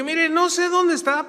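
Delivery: high, loud protest; retold insistence. Preacher insisting to the sick man about the passage, emphatic.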